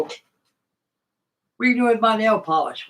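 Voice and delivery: mock mother's high, loud scold; scolding mom. Mother catching her son with her nail polish, high and loud. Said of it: "She said, she go".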